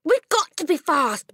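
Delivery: high, loud urgent cry; urging her teacher on; cried. A narrator's reading. Matilda cries out urgently to Miss Honey. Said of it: "Matilda cried".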